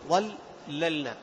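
ظللنا broken after the first lam, ظل - للنا; split by scansion. Shown as a demonstration. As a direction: slowly separate ظللنا into metrical pieces. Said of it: "تقطيع البيت والوزن". (تقطيع ظللنا كوزن البيت)

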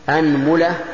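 "أنملة" said as anmula; opening a, middle u. Shown as a demonstration. Teacher lists variants for students, "أنملة" as anmula. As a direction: say "أنملة" with fatha on hamza, damma on mim. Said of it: "هذا في الفتح".

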